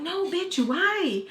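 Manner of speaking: retold defensive reply. Imitating friend asked for details, defensive. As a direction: push back in a high, puzzled voice.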